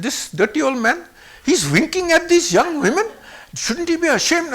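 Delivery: high, outraged retold complaint; indignant accusation. Men accuse the old man of winking, indignant.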